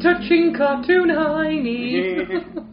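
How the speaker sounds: drawn-out, high, laughing through it. Real, not acted. Cracking up at the cartoon joke, laughing and drawn out. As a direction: stretch it out while cracking up.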